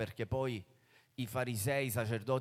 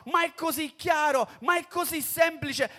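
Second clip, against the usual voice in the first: più alto e più forte, esasperato; sfogo esasperato; 13 semitones higher, 8 dB louder than usual. Acted. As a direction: lamenta l'incredulità altrui. Sfogo dei credenti. (il credente si sfoga sui colleghi, esasperato)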